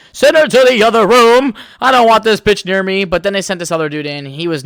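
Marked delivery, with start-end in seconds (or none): 0.1-3.0 higher, louder retold yelling at the nurses; mock yell. To the nurses, refusing the new girl: loud mock yell. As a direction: bark it out like you're refusing the needle.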